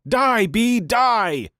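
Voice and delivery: high mock yell, little added volume; hammed-up, high-pitched war cry; retold battle yell. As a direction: imitate a kid yelling at a bee. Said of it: "quiet kid proceeded to yell".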